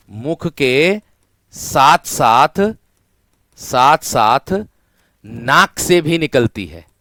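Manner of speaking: stretched, word by word, साथ साथ drawn out; slow teaching emphasis. Shown as a demonstration. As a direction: drag out साथ साथ so it sinks in. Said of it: "इसमें वायु मुख के साथ साथ नाक". (साथ साथ को खींचकर धीरे-धीरे बोलना)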